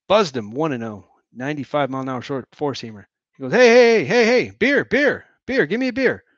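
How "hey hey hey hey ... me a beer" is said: loud, high, insistent hollering; yelling for beer. Acted. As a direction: bellow for a beer over the crowd.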